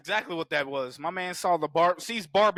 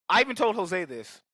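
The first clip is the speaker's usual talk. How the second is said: ordinary delivery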